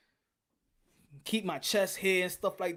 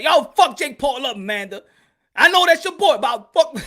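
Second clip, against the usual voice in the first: mock hype; 8 semitones higher, 13 dB louder than usual.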